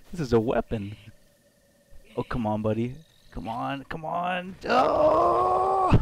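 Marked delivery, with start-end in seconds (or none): 2.2-5.9 rising pitch, building to a loud high cry; urging on. Real, not acted. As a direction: coax it along, then burst out in a long "Oh!". Straining, urging on in game.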